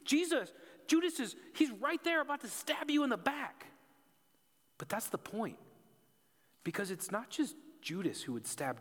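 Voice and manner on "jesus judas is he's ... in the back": high, loud mock protest; outraged disbelief. Us protesting to Jesus, high and loud. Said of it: "we're like".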